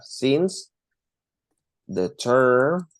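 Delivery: ordinary talk, nothing marked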